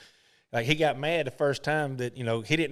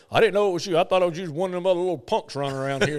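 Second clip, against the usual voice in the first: imitated, a touch louder; Arnold Palmer; 4 semitones higher, 4 dB louder, faster than usual. Palmer explaining he mistook Boo for a punk, imitated. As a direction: explain it with a chuckle. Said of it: "He goes".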